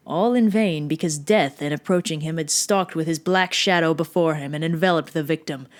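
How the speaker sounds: ordinary delivery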